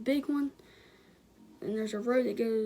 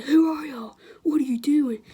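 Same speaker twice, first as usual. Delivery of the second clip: higher, louder mock challenge; retold angry demand; 4 semitones higher, 8 dB louder than usual. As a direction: bark it like an armed neighbor. Angry neighbor confronts with gun.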